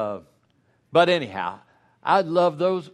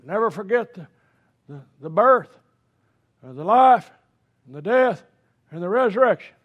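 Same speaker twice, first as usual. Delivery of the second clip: higher and louder solemn proclamation; dad preaching; 8 semitones higher, 9 dB louder than usual. Retold or imitated. Father at home suddenly exhorting the family, loud solemn preaching. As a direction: lay preacher declaring the creed.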